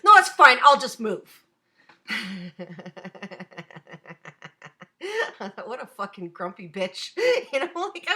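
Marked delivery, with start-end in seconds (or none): 0.0-1.4 loud, curt mock dismissal; grumpy brush-off. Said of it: "She goes". 2.1-5.6 soft, drawn-out amused chuckle; long laugh. Real, not acted. Laughing at the rude girl.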